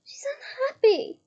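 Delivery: high, loud exasperated retort; a friend fed up with the elves. A narrator's reading.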